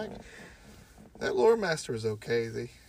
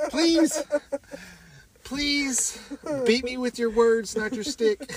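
much higher and louder, begging through laughter; high pleading mock voice; 12 semitones higher, 6 dB louder, slower than usual. Mocking a friend begging to be insulted, in a high pleading voice. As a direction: beg her like a giddy masochist. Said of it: "you're gonna say".

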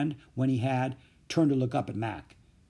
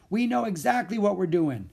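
higher, insistent call; raised, carrying boast; calling back; 7 semitones higher than usual. A narrator's reading. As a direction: call it over your shoulder. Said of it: "Louis called back over his shoulder".

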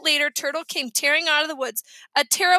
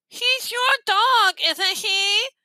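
high, slow retort through a held nose; stuffed-nose voice; 7 semitones higher, 3 dB louder, much slower than usual. A narrator's reading. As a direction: throw the blame back, nose pinched. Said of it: "they had the worst colds".

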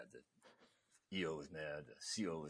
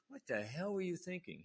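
higher-pitched mock chewing-out; retold angry yelling; 12 semitones higher than usual. Officer tearing into the chief for the mistake, retold angry yelling. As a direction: imitate a superior yelling at a subordinate. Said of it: "getting yelled at by the E".

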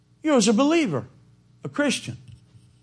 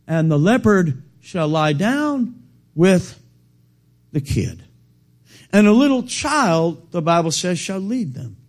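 ordinary talk

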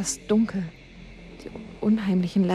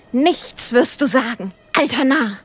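louder, slow, menacing snarl; angry threat; 4 semitones higher, 5 dB louder than usual. Acted. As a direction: threaten him like a man possessed. Possessed man threatens with gun.

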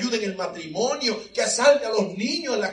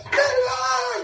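very high shouted ay; high, loud mock wail; shrill shout of ay; 3 dB louder than usual. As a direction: mimic the woman's wail.

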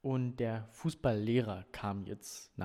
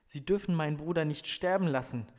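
narrated desperate plea, mildly raised; desperate cry; 4 semitones higher, 8 dB louder than usual. A narrator's reading. Brother pleads with the nurse for his sibling, mildly desperate cry. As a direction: plead urgently, a touch higher. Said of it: "schrie er verzweifelt".

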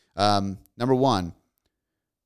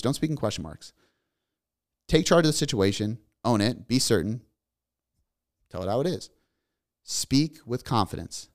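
usual speaking voice

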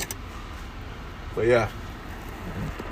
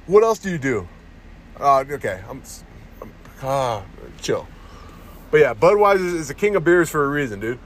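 ordinary talk, nothing marked

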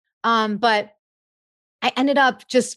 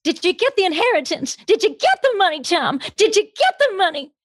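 high, insistent, hammed-up character voice; mock interrogation in a shrieky film-star impression; put-on movie character; 11 semitones higher than usual. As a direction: grill him in a theatrical high-pitched voice. Puts on movie voice, teasing Tom.

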